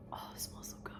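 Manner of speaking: hushed whisper, no voice; whispering over the scent of the primer. Real, not acted. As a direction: breathe it out in a whisper. Sniffing the primer.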